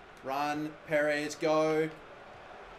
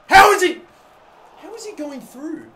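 sudden much higher, louder outburst; frustrated yell; 10 semitones higher, 12 dB louder than usual. Real, not acted. Player yelling at an opponent who keeps possession, frustrated yell. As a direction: bark it in disbelief.

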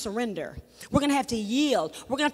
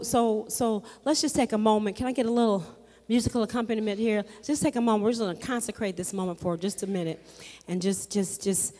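much lower and soft, settling the room; prayerful hush; 7 semitones lower, 6 dB quieter, faster than usual. Acted. Preacher guiding the congregation into quiet consecration, hushed. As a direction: bring them quietly into prayer.

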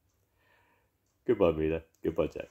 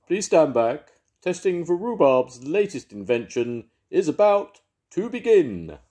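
higher, louder tannoy announcer voice; announcement; 9 semitones higher, 6 dB louder than usual. Acted. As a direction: warn the crowd over a siren. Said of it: "a voice announced".

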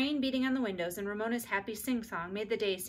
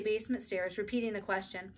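plain reading voice

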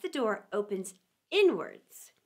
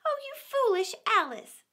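scolding herself; 10 semitones higher than usual. A narrator's reading. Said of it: "she answered herself".

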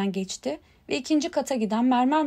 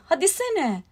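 higher, impatient urging; mild narrated shout; 5 semitones higher, slower than usual. A narrator's reading. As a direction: push it along, exasperated. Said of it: "diye bağırdı Harry".

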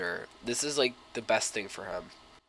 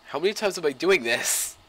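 ordinary delivery

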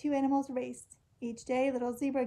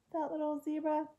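ordinary delivery, nothing marked

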